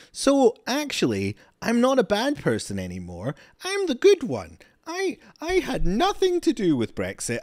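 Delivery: sarcastic high-pitched parody of a guilty politician; mocking politician impression. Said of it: "It's like".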